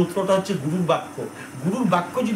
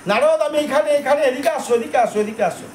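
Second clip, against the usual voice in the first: calling out; 6 semitones higher than usual. Acted. Krishna calls out to Narada.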